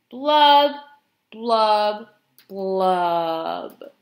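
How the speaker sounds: strong first blub, then sinking; glum fish blubs. Acted. Pout-pout fish ending his dreary refrain, descending glum blubs. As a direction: drop each blub well below the last.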